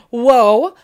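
a loud, sudden whoa; startled whoa. Real, not acted. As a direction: let it burst out of you. Reacting to the message.

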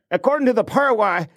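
mock Boston accent, quoting a buddy; imitating a friend's Boston accent, pitched high.

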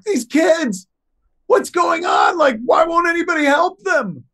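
high, loud distraught pleading; mock panicked girlfriend voice; hysterical girlfriend. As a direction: shout it out, convinced the kids are really dying. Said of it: "a hysterical Jennifer Schwalbach".

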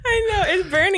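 laugh-crying. Real, not acted. Eyes burning, laughing.

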